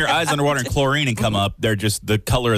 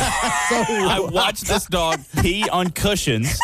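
laughing through the words, slow; 6 semitones higher, much slower than usual. Real, not acted. Friend laughing while vouching for the dog story. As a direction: giggle the story out slowly. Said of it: "I can a hundred percent vouch for this".

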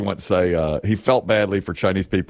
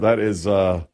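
ordinary talk, nothing marked

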